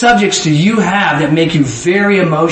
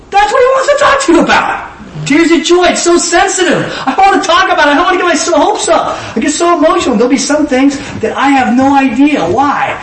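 much higher, lifted voice of someone overwhelmed with joy; emotional believer voice; 7 semitones higher than usual. Acted. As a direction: play the overjoyed, tearful believer.

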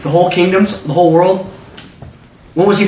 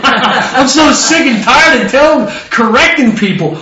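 high, slow complaint with a laugh in it; mock fed-up; 5 semitones higher, 4 dB louder, slower than usual. Joseph tired of correcting people, hammed-up fed-up complaint. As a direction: lament it loudly, half laughing. Voices Joseph weary of explaining.

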